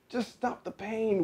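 high, slow pleading whimper; acted whimper. A narrator's reading. Fox in pain to the dentist, whimpering. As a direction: whimper it slowly in high pitch. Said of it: "whimpered the fox".

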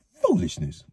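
high, grumbling villager voice; grumbled. A narrator's reading. Thatcher grumbling at the mayor's plan. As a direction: scoff at the idea, grumpy. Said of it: "Sean grumbled".

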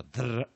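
嘚儿 rolled on the tongue tip; Spanish trilled R. Shown as a demonstration. Teacher citing how Spanish learners say it, trilled 嘚儿. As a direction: flutter the tongue through 嘚儿. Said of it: "它是个战舌音哈".

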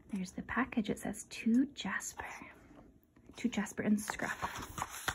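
hushed whisper to the listener; whispered aside. Real, not acted. Reader pointing out the package to a child, whispered. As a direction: lean in and whisper it.

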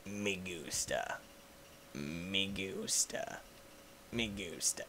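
deep, slow, soft murmur; low quiet meme voice. Acted. Meme phrase repeated.

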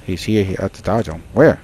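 usual speaking voice

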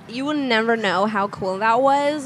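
usual speaking voice

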